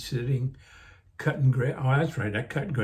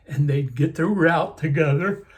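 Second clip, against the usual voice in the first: yawning; 2 semitones higher, 5 dB louder than usual. Real, not acted. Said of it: "Sorry, I'm yawning".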